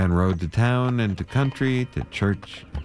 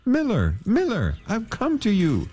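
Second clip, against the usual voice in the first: high singsong call to the miller; plea at the mill door; 12 semitones higher than usual. Acted. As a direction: call out pleading. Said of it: "called to the dusty miller".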